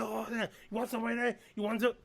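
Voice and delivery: high taunting, shooting crazy; cocky taunt. Retold or imitated. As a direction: taunt the aliens like a marine. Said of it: "He was like".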